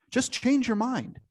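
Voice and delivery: high, slow sarcastic quip; mock the useless advice; mocking dismissive.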